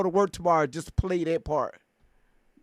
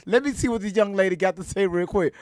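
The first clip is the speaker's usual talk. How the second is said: ordinary talk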